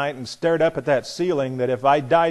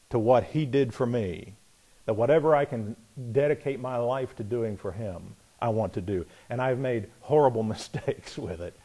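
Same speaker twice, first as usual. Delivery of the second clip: ordinary delivery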